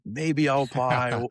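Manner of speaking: mock noncommittal maybe. Brushing off the camp leader with a vague maybe. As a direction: dodge the offer with a vague maybe. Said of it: "I hit him with the maybe card".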